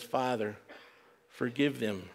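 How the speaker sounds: low, soft, weary plea; lightly acted suffering, voice dropped and hushed; pained plea. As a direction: plead quietly, as if worn down by pain. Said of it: "He's in this pain and agony".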